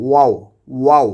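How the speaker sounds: ওয়াও with both lips rounded; rounded waw. Shown as a demonstration. Teacher showing students the rounded lips for ওয়াও. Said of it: "ঠোঁট দুটি গোল করে উচ্চারণ করব".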